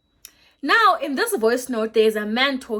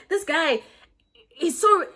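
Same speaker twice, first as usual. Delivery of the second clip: incredulous outburst; 6 semitones higher, slower than usual. Retold or imitated. Telling viewers about the lying date, incredulous and raised. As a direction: blurt it out like you can't believe his nerve. Retelling her disbelief at his lie.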